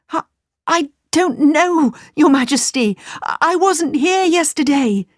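flustered, high stammer; confused stammering. Acted. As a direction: stumble over the words. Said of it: "He was very confused".

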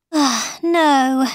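high, sighed admission of defeat; sighing. A narrator's reading. Alice giving up on the Hatter's riddle, sighing. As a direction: let it out on a sigh. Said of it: "she sighed".

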